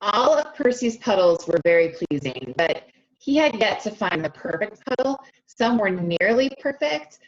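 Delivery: put-on lively storybook reading for young kids; read-aloud narration. A narrator's reading. Teacher reading a picture book to her class, animated read-aloud. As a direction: read it like circle time, bright and inviting.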